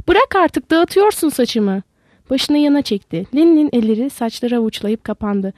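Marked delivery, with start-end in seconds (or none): 0.1-1.8 sudden shout. A narrator's reading. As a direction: snap out a startled yell. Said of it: "Sonra birden bağırdı".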